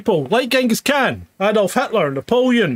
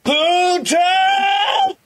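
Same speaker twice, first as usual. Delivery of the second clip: mock shout; 10 semitones higher than usual. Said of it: "comic exclaim".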